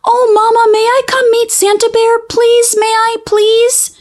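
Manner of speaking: put-on high, pleading child voice, quick; begging little cub. Young cub pleading with Mama to meet Santa, eager. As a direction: beg Mama sweetly, all on a high pitch.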